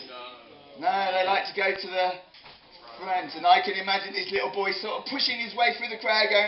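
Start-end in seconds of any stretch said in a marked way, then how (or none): none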